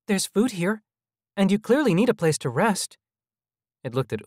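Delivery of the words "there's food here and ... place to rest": put-on high, eager little-boy voice; persuading child. A narrator's reading. Child hologram offering food and rest, high and coaxing. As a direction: coax them in a high child's voice.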